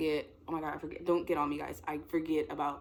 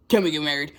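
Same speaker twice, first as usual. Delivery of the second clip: loud, blurted pretend proposal; suddenly loud, playing starstruck; mock fan outburst; 12 dB louder, faster than usual.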